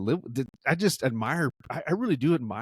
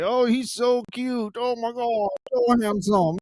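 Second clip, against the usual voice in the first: high, louder swooning fangirl voice; swooning girl; 10 semitones higher, 3 dB louder than usual. Pretend. A girl gushing over the handsome doctor, high swooning voice. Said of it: "some girl's gonna be like".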